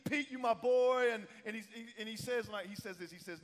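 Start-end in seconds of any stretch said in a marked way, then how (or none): 0.1-1.1 high, loud celebratory cheer; hyped-up congratulations, pitched high; celebrating. Acted. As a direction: cheer him on with a raised, louder voice. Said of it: "again, he's celebrating".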